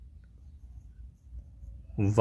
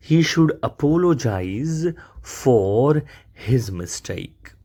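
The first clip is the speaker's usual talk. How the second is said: slow teaching read; 2 semitones higher, much slower than usual. Shown as a demonstration. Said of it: "teacher models the sentence".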